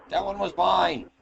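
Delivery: mock elf claiming a kill; competitive elf. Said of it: "like the elf and the fucking dwarf".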